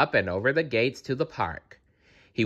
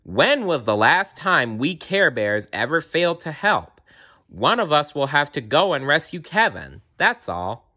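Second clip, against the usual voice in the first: exasperated grumbling, pitched up; Grumpy Bear; 6 semitones higher than usual. A narrator's reading. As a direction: scold the others impatiently. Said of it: "grumbled Grumpy Bear".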